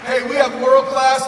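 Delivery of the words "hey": normal talking voice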